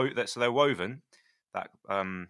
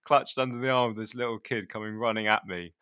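ordinary delivery

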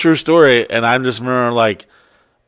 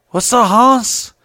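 lazy, higher-pitched provocation; mocking gangster greeting; 5 semitones higher, slower than usual. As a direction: stretch out each word as a mock challenge.

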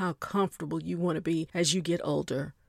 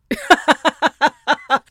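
loud burst of laughter; 8 semitones higher, 9 dB louder than usual. Real, not acted. Laughing at her own champagne joke.

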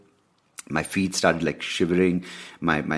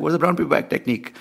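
baffled; 12 semitones higher, 3 dB louder, faster than usual. Retold or imitated. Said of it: "And I was like".

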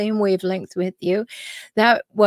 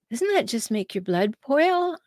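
normal talking voice

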